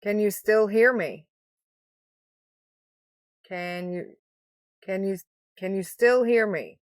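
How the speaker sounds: speaking through a covering hand; hand over mouth. Real, not acted. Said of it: "I've got my hand over my mouth".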